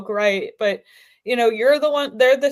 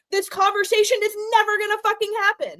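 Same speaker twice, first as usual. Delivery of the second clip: exasperated; 11 semitones higher than usual. Real, not acted. Climax of a heated complaint.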